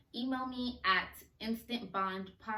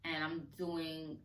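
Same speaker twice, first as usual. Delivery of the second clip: normal talking voice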